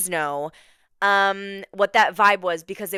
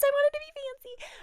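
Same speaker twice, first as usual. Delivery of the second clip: very high playful squeal; playfully fancy. Real, not acted. Host showing off Diet Coke in a wine glass, playfully fancy. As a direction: pitch it way up, mock-fancy.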